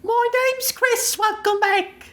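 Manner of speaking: high, slow put-on voice; copying the blanket voice. Cohost copies her voice to open the show. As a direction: mimic her silly high voice. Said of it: "is it your under the blanket voice?".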